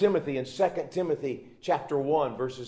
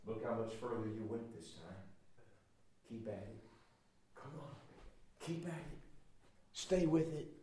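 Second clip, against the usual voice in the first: much softer, gentle coaxing; tender encouragement; 3 semitones lower, 11 dB quieter than usual. Acted. A loving dad urging his toddler on, soft and tender. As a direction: ease the boy on, hushed and kind.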